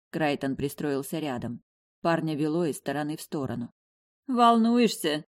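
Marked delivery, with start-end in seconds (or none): 4.3-5.2 faintly amused, a bit higher; tired teasing smirk; weak smirk. A narrator's reading. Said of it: "усмехнулась слабо".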